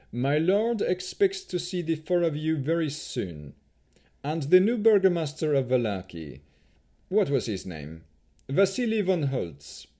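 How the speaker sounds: 1 haughty elf chamberlain. A narrator's reading. Rahadin voiced in narration.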